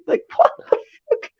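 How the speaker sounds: drawn-out, high-pitched disbelief; thin, stretched-out cry of real bafflement; incredulous. Real, not acted. Disbelief at double standard in coverage.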